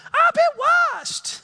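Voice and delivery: high shouted burst then drop; excited shout. Shown as a demonstration. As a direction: shout it out to stir them. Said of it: "Get excited here".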